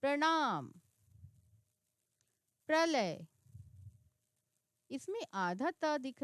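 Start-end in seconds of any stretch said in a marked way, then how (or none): 0.0-3.2 louder, crisp teacher demo; model word reading. Shown as a demonstration. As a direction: sound the र in प्रणाम and प्रलय clearly. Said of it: "इसमें र का उच्चारण सुनाई दे रहा है".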